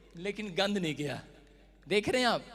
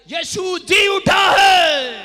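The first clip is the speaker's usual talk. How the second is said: very loud, high, drawn-out cry; acted-out scream; 9 semitones higher, 18 dB louder, much slower than usual. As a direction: scream it slowly as a public proclamation. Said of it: "जोर से चीखा और बोला".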